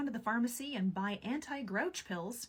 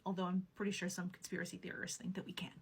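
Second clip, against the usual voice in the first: whispered aside, softer; conspiratorial whisper; 4 semitones lower, 5 dB quieter than usual. Acted. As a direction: whisper it like sharing a secret. Aside about conspiracy theorists.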